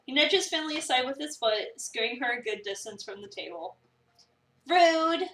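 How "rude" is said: loud, higher indignant cry. Acted. Pushed aside by Reese, loud indignant Rude. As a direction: call out the rudeness.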